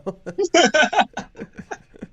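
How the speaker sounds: big high burst of laughter; loud laugh. Real, not acted. After reading funny comment.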